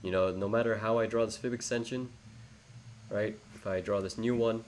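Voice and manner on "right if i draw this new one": normal talking voice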